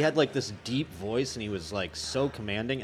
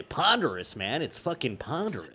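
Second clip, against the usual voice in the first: imitating Casey Kasem; 6 semitones higher, 5 dB louder than usual. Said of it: "What does he say?".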